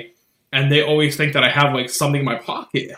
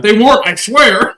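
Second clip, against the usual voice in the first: higher and much louder pleading denial; pleading protest; 9 semitones higher, 10 dB louder than usual. Retold or imitated. Friend quoting her plea to airport security, loud and high. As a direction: insist you're innocent, loud and high. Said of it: "she goes".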